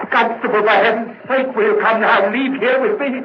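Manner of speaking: very high, loud, near breaking; on the edge of screaming, plead with everything; hysterical begging. Acted. Murderer breaks down, begs constable.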